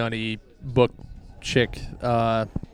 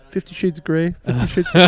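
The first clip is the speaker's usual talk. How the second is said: mock excited ladies; 5 semitones higher, 4 dB louder, faster than usual. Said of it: "they were all like".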